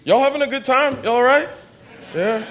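preacher calling out to the room, high and loud; hyping the crowd. Real, not acted. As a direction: pump the congregation up.

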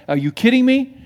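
scoffing listener; incredulous scoff. Retold or imitated. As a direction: treat it as a joke. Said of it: "they're going".

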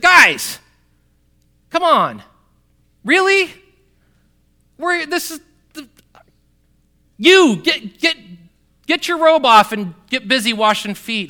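loud, high exasperated scolding; full-on rant. Acted. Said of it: "full-on rant mode".

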